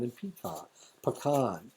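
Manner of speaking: "pecan" said with an AH in the last syllable; "pecan" ending in a broad -kahn; pronunciation shown. Shown as a demonstration. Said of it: "they pronounce pecan and pecan".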